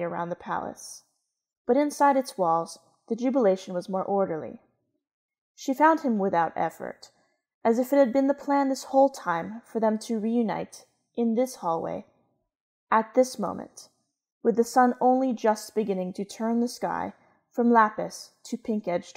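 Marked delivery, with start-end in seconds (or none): none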